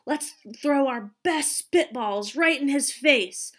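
loud, conspiratorial stage whisper. A narrator's reading. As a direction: plot it with relish. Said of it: "whispered to the group".

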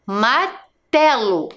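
slow "Martelo", split into syllables; reading out slowly. Shown as a demonstration. Teacher reading a word to young pupils, slow reading out. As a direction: stretch "Martelo" with a break inside. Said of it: "está escrito o quê?".

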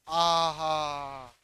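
long low aha of satisfaction; slow, low pleased aha; drawn-out satisfied aha. Real, not acted.

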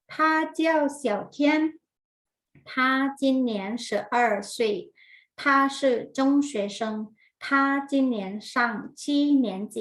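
slow, word-by-word language drill; teacher modelling sentences. Shown as a demonstration. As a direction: say each word separately, with long gaps. Models Chinese sentences for repetition.

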